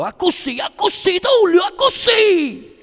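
high, slow, triumphant yell; a coach screaming his fighter's name after the knockout; jubilant shout. Acted. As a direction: scream it from the corner ropes in triumph. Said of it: "Sentì un urlo dal mio angolo".

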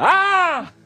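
very high, loud terrified scream; high-pitched "ah!" of terror. Acted. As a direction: shriek in sudden fright. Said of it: "tre gridi di terrore".

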